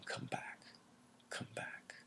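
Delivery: breathless whisper, fading away; a touch of tearful whispering; faint whispered plea. A narrator's reading. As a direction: call him back in a fading whisper. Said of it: "she might have been crying".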